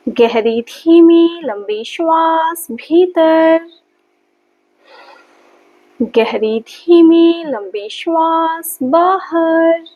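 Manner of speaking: slow, sustained, stretched-out breath cues; measured yoga-teacher breathing count on a held tone; guided breathing. Acted. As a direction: draw each word out to pace a long breath.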